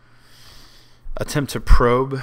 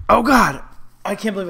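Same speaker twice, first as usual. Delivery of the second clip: sudden loud, high cry of dismay; shocked outcry; 8 semitones higher, 6 dB louder than usual. Real, not acted. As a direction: blurt it out as the mistake hits. Realises the misclick.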